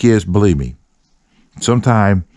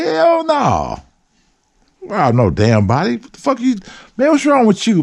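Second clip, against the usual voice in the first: incredulous refusal; 13 semitones higher than usual. Retold or imitated. Refusing to join the robbery.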